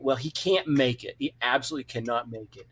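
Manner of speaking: ordinary delivery, nothing marked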